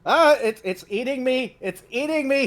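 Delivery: very high-pitched frightened creature; hammed-up terror, voice shot way up; panicked squeal. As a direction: squeal as if being eaten alive. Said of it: "he says, bunching up in the corner".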